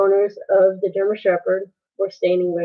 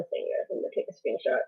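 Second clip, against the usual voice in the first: whispered aside; 2 semitones higher, 11 dB quieter, much faster than usual. Real, not acted. Aside while grabbing a screenshot.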